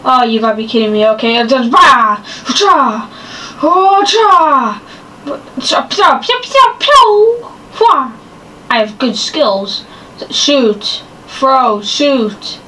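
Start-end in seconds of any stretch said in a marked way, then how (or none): 6.2-7.5 pew pew sounds. Pretend. Fighting zombie, gun noises.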